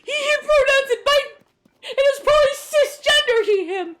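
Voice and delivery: squealed, teary laughing rant; laugh-crying. Real, not acted. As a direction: protest while cracking up. Laugh-crying reply to chat.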